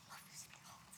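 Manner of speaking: soft breathy whisper of love; a mother's hushed "Love you, sweetheart". Real, not acted. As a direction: whisper it tenderly to your daughter. Mom closes speech, whispered aside.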